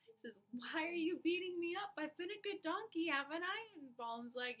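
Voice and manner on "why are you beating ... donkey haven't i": high, loud hurt donkey voice; the donkey cries out, much higher and louder, wounded by the beating; pleading talking donkey. Acted.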